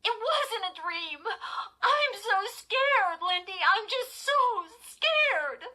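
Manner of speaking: high, loud, drawn-out wail; hysterical fear. A narrator's reading. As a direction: lose control and wail in terror. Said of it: "feeling herself lose control".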